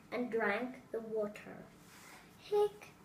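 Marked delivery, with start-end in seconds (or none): 2.4-2.9 higher hiccup; a quick higher hiccup. Acted. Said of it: "Mom said".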